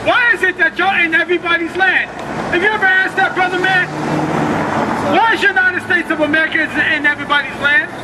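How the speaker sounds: normal talking voice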